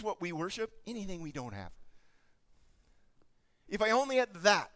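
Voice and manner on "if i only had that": louder, craving it out loud; a sudden loud cry of wanting; loud greedy longing. Retold or imitated. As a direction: blurt the wish like a greedy child.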